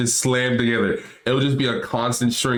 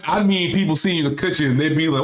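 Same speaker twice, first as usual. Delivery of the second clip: ordinary delivery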